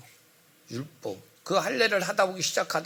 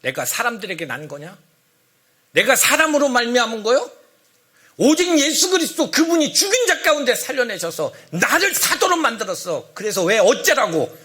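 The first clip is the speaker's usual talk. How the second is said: heated, indignant challenge building from restrained to loud; angry, emotional reading; 3 semitones higher, 5 dB louder than usual. Shown as a demonstration. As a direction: read the letter as an angry retort. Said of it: "여기다 감정을 넣는다면 그렇게 읽는 거예요".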